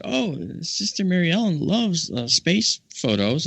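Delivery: bubbly mild imitation of the other nun; retold eager nun. Nun tells host about her companion's hobby, lightly eager imitation.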